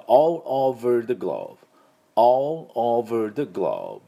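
all over the globe very slowly, each word clear; slow model phrase. Shown as a demonstration. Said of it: "ということになります".

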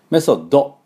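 メソッド with a full Japanese "do" at the end; Japanese-style メソッド, final vowel sounded; wrong final O. Shown as a demonstration. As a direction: say メソッド with an added O after the D. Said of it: "Oをつけてはいけません".

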